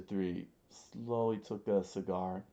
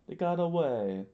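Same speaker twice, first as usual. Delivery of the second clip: high, louder voice falling at the end; raised, high-voiced explanation; character explaining; 9 semitones higher, 6 dB louder than usual. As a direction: report it in a pitched-up character voice. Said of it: "he explained".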